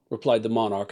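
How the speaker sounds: plain reading voice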